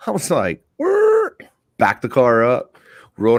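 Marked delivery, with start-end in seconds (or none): 0.8-1.4 high mouth noise of a car window; imitated whir. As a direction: make the window sound with your voice.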